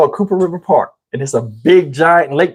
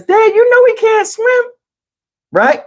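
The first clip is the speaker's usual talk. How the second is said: very high mock girl's voice; protesting child; 14 semitones higher than usual. Mom warning her dad he can't swim, high protesting voice. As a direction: plead high-pitched like a daughter to her dad.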